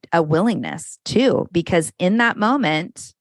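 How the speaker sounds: ordinary talk, nothing marked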